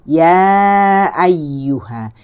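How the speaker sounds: Ya ayyuha, very slow and loud with the ya held open; drawn-out ya. Shown as a demonstration. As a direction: stretch the ya in ayyuha out long. (ya dipanjangkan dan terbuka, perlahan)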